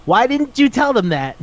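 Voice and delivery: high, disbelieving question; incredulous. Retold or imitated. Retelling a show to a friend, disbelieving. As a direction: voice jumps up in disbelief. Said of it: "I was like".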